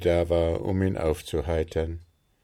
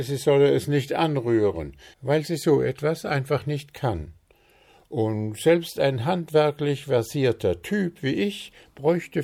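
higher, louder, self-important gnome voice; Puh the gnome; 7 semitones higher, 5 dB louder than usual. Acted. Puh tells friends about the blocked drain, high and loud. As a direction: boast about his plan, pitched up.

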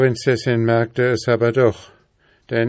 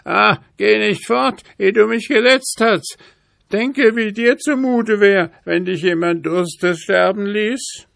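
high, louder wailing raven plea; pitiful voice; 11 semitones higher, 5 dB louder than usual. A narrator's reading. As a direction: wail so it would soften a stone. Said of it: "mit kläglicher Stimme". (hohe, klägliche Rabenstimme)

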